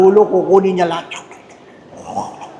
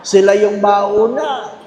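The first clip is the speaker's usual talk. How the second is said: normal talking voice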